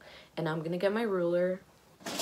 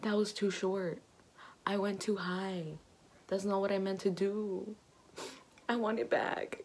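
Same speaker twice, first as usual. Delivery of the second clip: ordinary delivery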